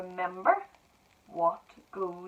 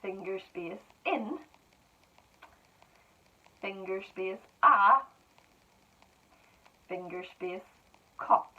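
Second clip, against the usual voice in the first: slow dictation. Shown as a demonstration. Teacher dictating to pupils, words spaced apart. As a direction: spell it out for children, word by word.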